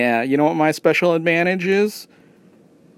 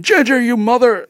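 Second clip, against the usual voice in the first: retold scream; 8 semitones higher, 4 dB louder than usual. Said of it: "Somebody screaming".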